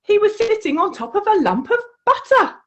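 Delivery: usual speaking voice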